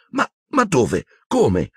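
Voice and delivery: high-pitched stammered questions; pale alarm. A narrator's reading. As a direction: blurt it out in alarm. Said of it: "domandò Hermione pallidissima". (sgomenta, balbettando)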